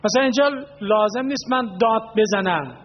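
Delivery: ordinary delivery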